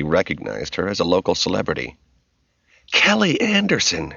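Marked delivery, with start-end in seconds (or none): 2.9-4.1 high-pitched, delighted gush. A narrator's reading. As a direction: gush over meeting a local celebrity. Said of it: "she gushed".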